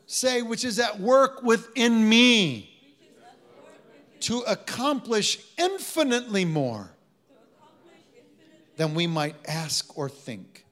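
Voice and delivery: very loud, high and slow; rousing scripture declaration. Real, not acted. Preacher has the congregation say the verse, at full force. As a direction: drive each word home at full force.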